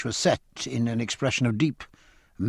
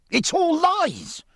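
a much higher, slow, furious cry; passionate cry; much slower than usual. Acted. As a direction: hurl the denial in a rage. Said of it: "cried George Rogers".

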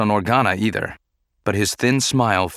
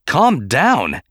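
higher, incredulous retort; angry echo; 5 semitones higher, 4 dB louder than usual. A narrator's reading. Senator echoing Padme's plea, angrily incredulous. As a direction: fling the words back in anger. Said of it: "he echoed".

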